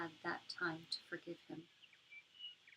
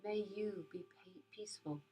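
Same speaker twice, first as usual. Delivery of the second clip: soft spa whisper; 4 dB quieter than usual. Acted. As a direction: guide them gently into calm. Leads a metta meditation.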